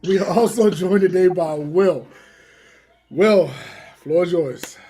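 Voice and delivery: loud, high announcer shout; hype announcer. Acted. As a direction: hype him up like a ring announcer. Hyped intro of co-host.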